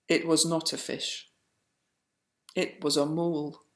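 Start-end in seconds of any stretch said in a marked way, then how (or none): none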